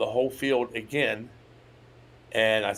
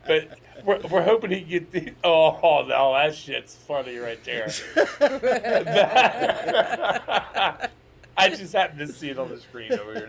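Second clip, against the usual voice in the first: laughing; 7 semitones higher, 5 dB louder than usual. Real, not acted. Laughing at screen comment.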